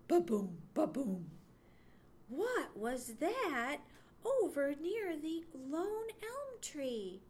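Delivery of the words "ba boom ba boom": low, loud heartbeat imitation; pounding heartbeat. A narrator's reading. Johnny's heart giving a great throb, thumping. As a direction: thump it out in two beats. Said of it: "gave a great throb".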